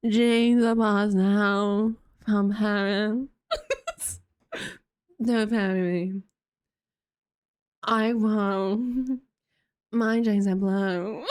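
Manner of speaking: drawn-out, slurred parody of an ad voice. Retold or imitated. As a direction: stretch each word out in a hammed-up hillbilly impression. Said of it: "She's like".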